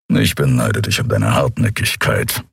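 deep rumbling creature grumble; low grumble. A narrator's reading. Big furry mechanic to stubborn Endriel, deep grumbling. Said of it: "brummte Kero".

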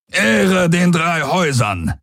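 high, drawn-out rallying cry; slow, soaring war cry; battle cry. A narrator's reading. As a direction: call out the house motto while charging. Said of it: "rief Daraios und warf sich in die Schlacht".

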